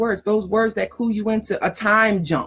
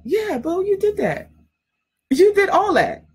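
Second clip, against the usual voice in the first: much higher, delighted hype for a friend; excited, much higher praise; cheering her on; 11 semitones higher, 3 dB louder than usual. Acted. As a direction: hype the client up like a proud friend. Cheering a client on.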